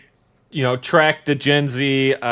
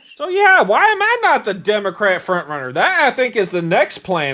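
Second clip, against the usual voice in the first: mock screaming rant; 8 semitones higher, 5 dB louder than usual. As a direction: still hammed up, higher and louder. Keeps up the mock screaming bit.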